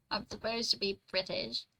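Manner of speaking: badly faked British accent; shaky British accent. Streamer admits it's not very good, mock British accent. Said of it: "I know, it's not very good".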